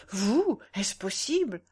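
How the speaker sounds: stunned, disbelieving question; a burst of narrated astonishment; astonished exclamation. A narrator's reading. Man startled by a woman's night visit, astonished. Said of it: "s'écria-t-il".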